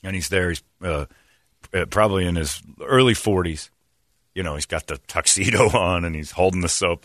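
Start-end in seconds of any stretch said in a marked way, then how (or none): none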